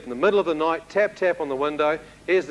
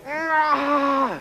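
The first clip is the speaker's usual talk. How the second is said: yell back; 10 semitones higher than usual. Retold or imitated. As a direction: scream right back in fright. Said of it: "And I went".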